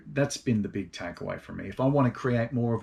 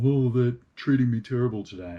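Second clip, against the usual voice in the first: a touch of self-pitying grumble, raised a little; lightly hammed-up sulky complaint; mock resentful whine; 3 semitones higher, 6 dB louder than usual. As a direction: imitate your own petty resentment, lightly. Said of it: "me getting resentful".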